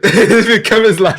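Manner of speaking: words through a loud laugh, high. Real, not acted. Host cracking up at cohost's birthplace, laughing. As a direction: crack up while talking.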